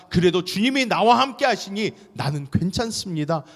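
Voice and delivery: high, loud fervent sermon; impassioned preaching. Acted. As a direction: preach it with full fire. Preaching at full force.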